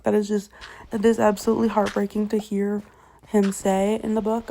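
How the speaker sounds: ordinary talk, nothing marked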